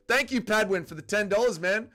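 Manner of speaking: normal talking voice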